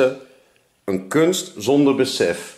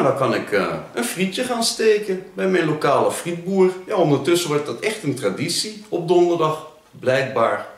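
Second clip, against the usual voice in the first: usual speaking voice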